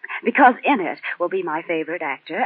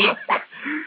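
breathy laugh; 11 semitones higher than usual. Real, not acted. Laughs at her own joke.

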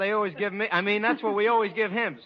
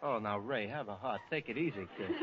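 quiet plea; 8 semitones lower, 11 dB quieter than usual. Acted. Pleading quietly with friend.

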